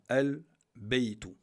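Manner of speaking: very slow, loud "Al-baytu" with the L held clearly; lam pronounced. Shown as a demonstration. Teacher demonstrating how the written lam is pronounced, slow and emphatic. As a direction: stretch out "Al-baytu", sounding every L. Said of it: "à la prononciation".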